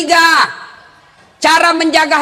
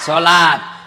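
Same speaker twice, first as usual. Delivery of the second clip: ordinary talk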